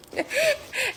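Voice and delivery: high-pitched gleeful giggle; high delighted giggle. Real, not acted. Laughs about crying kids.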